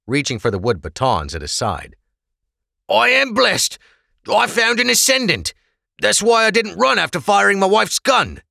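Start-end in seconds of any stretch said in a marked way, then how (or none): 2.9-8.4 high, loud, urgent plea; desperate prisoner. Acted. Prisoner to officers, loud desperate insistence. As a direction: cry out your claim to save yourself.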